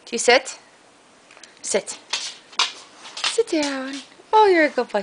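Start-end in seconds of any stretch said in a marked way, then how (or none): 4.3-5.0 higher, fond dog praise; praising dog. Real, not acted. Owner praising her dog for sitting, high fond voice. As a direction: tell the dog he's good.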